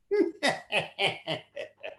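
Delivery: high villain laugh; sinister cackle; evil laugh. Acted. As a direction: gloat like a wicked magician. Magician laughs at soldiers.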